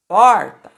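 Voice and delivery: "Porta" with a loud punched "Por"; stressed penultimate syllable. Shown as a demonstration. Teacher showing a paroxytone, "Porta" loud on the "Por". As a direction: hit the "Por" of "Porta" hard. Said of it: "a penúltima sílaba é o por".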